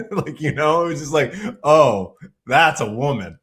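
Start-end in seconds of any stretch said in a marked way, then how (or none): 1.6-3.4 impressed exclamation. Retold or imitated. Cousin's impressed reaction at dinner.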